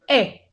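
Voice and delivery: e said with an a-shaped mouth; open French e. Shown as a demonstration. Teacher demonstrating the open e to the class. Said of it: "y dices e".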